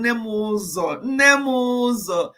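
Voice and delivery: affectionate calling out to a viewer; calling out a name. Real, not acted. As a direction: call her name three times, stretching it. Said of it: "How many times did I call you?".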